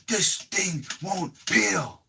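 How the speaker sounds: high, strained and drawn-out; mock frustrated whine, stretched word by word. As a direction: slowly voice his exasperated struggle, high-pitched. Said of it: "he's getting frustrated".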